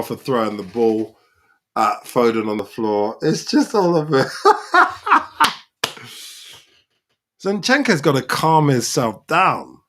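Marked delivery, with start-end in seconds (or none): 4.3-5.5 high, loud burst of laughter. Real, not acted. Fan at the screen laughing as the match boils over. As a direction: crack up at the chaos.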